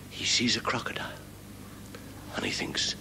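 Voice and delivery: whispered, breathless aside; hushed. Acted. Narrator hushing as the frog spots the crocodile, in a whisper. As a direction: whisper as if not to wake it.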